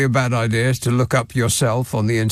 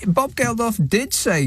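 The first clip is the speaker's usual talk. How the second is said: usual speaking voice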